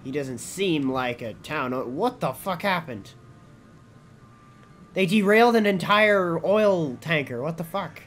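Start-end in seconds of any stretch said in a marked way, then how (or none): 1.9-8.0 shocked outburst. Real, not acted. Sees a derailed oil tanker.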